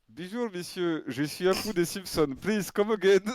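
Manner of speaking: put-on Indian accent in French and English; Apu. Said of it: "l'accent indien".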